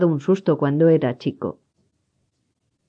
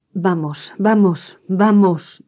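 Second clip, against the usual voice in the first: agitated repetition; 3 semitones higher, slower than usual. A narrator's reading. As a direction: build through three urgent, separate bursts. Jamie agitated, repeats himself when accused.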